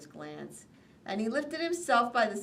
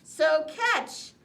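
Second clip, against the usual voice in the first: calling out; 9 semitones higher, 7 dB louder than usual. A narrator's reading. Calls out.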